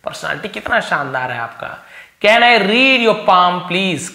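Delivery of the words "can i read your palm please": slow, loud polite question; slow reading. Shown as a demonstration. Teacher voicing the fortune teller asking to read a palm, slow. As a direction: read the request out slowly.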